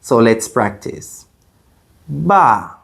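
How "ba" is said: "Ba" starting high, dropping sharply; short, steeply falling "Ba"; fourth tone. Shown as a demonstration. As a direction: snap "Ba" down like a stern command. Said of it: "falls quickly and sharply".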